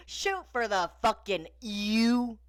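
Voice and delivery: imitated catchphrase, slow and high; lightly imitated toy cowboy line; Woody. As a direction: parrot the catchphrase, drawing it out. Mockingly repeating Woody's catchphrase.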